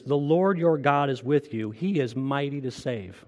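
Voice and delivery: slow, deliberate reading of the verse; slow scripture reading. Shown as a demonstration. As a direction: let each word of scripture land, slowly. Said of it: "I wanna read it slow".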